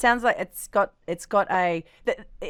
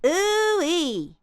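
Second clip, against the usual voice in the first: high ooh-wee, resonance at the cheekbones; less nasal; 6 semitones higher than usual. Shown as a demonstration. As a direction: bring the resonance down out of the nose. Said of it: "come back down".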